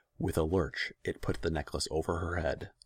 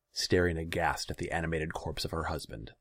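plain reading voice

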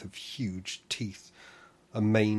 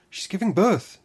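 loud, much higher panicked shout; 11 semitones higher, 12 dB louder than usual. Acted. Tamsy shouting the news to Nab, loud and high. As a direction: blurt it out at full voice. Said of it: "Tamsy shouted at me".

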